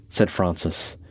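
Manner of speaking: ordinary delivery, nothing marked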